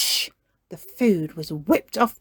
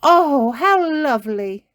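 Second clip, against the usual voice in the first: delighted; 7 semitones higher, 9 dB louder, slower than usual. A narrator's reading. Mummy Pig delighted.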